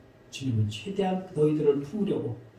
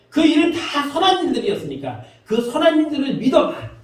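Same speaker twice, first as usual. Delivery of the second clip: impassioned preaching; 9 semitones higher, 6 dB louder than usual. Acted. Preacher voicing Jesus to doubters, impassioned plea. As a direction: preach it at full force, urging them to believe.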